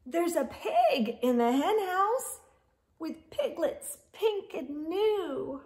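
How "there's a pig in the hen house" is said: usual speaking voice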